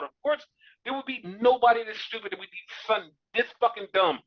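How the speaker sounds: ordinary talk, nothing marked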